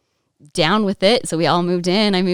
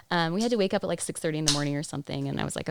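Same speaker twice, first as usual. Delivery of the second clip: ordinary talk, nothing marked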